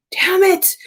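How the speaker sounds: high whiny "damn it"; hammed-up annoyed whine; mock frustration. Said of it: "And I said".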